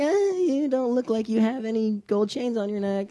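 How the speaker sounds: mocking rejection. Mocks other girls turning him down.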